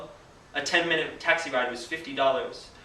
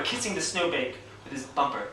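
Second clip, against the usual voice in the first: ordinary delivery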